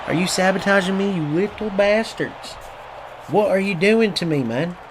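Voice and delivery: ordinary talk, nothing marked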